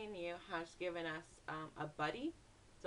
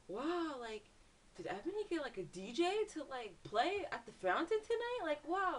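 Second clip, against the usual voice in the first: high, loud retold amazement; wowed by an unexpected surprise; amazed reaction; 8 semitones higher, 5 dB louder than usual. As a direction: marvel out loud, pitch leaping up.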